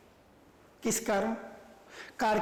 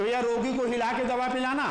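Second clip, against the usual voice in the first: lightly voiced doctor, pitched a little up; a hint of a doctor giving orders; doctor's instruction; 5 semitones higher than usual. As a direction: instruct the patient's guardian. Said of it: "doctor ने बोला कि".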